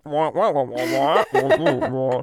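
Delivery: Charlie Brown adult trombone voice; muffled wah wah talk. Retold or imitated. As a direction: wah it like a muted horn. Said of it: "children sound like".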